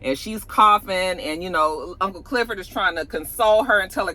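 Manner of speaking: ordinary talk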